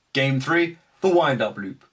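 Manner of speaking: ordinary talk, nothing marked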